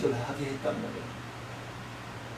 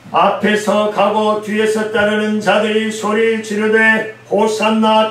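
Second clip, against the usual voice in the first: slow, loud, lifted scripture proclamation; proclaimed scripture reading; 4 semitones higher, 5 dB louder, slower than usual. Acted. Preacher reading the Palm Sunday text aloud to his congregation, slow loud proclamation. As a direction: ring out each Hosanna line. Reads scripture at full force.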